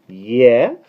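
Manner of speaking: ordinary delivery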